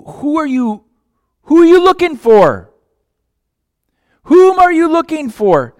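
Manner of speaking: high and loud questioning; urgent cry. Acted. Jesus pressing Mary on whom she seeks, high and loud.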